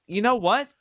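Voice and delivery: ordinary talk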